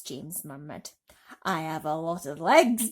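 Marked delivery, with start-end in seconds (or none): 1.4-2.9 proudly. Acted. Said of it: "answered proudly".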